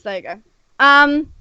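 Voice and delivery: ordinary talk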